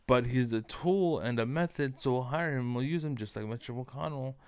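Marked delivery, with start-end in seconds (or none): none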